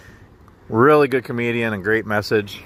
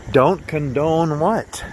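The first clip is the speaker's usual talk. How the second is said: a higher, slow mock-indignant wife's voice, drawn out; upset wife; 5 semitones higher, much slower than usual. Husband retelling how his upset wife snapped back at him, in mock indignation. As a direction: play the offended wife, stretching every word. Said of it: "And she says".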